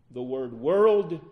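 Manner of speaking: "world" drawn out slowly and loud; stress on "world". Shown as a demonstration. Preacher showing a misunderstood emphasis, loud slow stress on "world". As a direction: lean hard and long on "world". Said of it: "the emphasis is given on".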